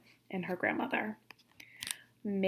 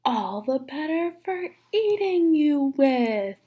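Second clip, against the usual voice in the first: higher, louder, slow big bad wolf; menacing wolf; 5 semitones higher, 5 dB louder, slower than usual. Wolf revealing himself to the girl, slow menacing wolf voice. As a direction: savor the threat like the wolf about to pounce.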